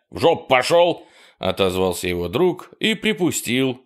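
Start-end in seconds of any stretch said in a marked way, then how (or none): 0.1-1.1 shouting back. A narrator's reading. Friend shouts back while running.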